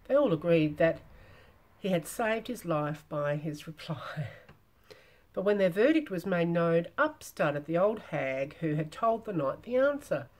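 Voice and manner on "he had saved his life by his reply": plain reading voice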